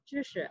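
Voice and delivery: Zhi said light and unstressed; light neutral tone. Shown as a demonstration. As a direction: toss off Zhi softly, no tone. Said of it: "jadi bacanya ringan".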